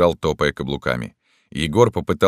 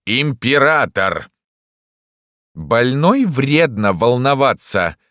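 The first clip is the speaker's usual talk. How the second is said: raised, loud, slow creaky reproof; creaky-voiced doctor; 9 semitones higher, 6 dB louder, slower than usual. A narrator's reading. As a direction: scold in a squeaky, creaking voice. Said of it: "сказал доктор скрипучим голосом".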